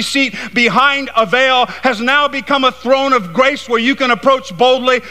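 high, driving preacher's delivery; impassioned preaching. Real, not acted. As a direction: preach it with rising force, pitched high. Preaching at full force.

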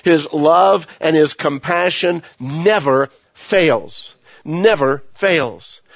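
"Never fails" stretched very slow; slow insistence. Real, not acted. Preacher assuring the congregation, very slow "Never fails". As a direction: drag out "Never fails" to let it land.

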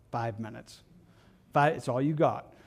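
a low, quiet, curt CEO; a hint of an impatient executive; curt boss. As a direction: brush them off like a busy boss.

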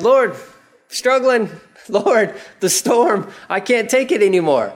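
high, pleading cry to the Lord; desperate plea. Acted. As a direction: beg for help, voice up high. Disciples crying out to Jesus.

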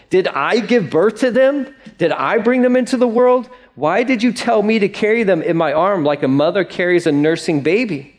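rising, pleading complaint to God; fed-up Moses. Acted. Moses pleading with God over the whining people, exasperated. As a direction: Moses at the end of his rope, begging. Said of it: "Moses is done".

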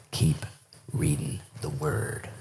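very low, slow, weighty counsel; truth and power. Retold or imitated. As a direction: let each word land with quiet power. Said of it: "with truth and power, he said".

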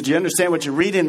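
fast, run together as a plain quote; quick read-through. Acted. Preacher rereading Philip's question quickly in passing. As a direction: rattle it off quickly. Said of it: "He says".

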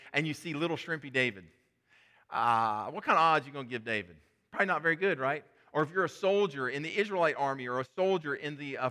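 normal talking voice

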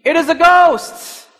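sudden high, loud cry of fright; frightened cry. Retold or imitated. Disciples in the storm crying out in fear. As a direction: shout it like sailors seeing a ghost.

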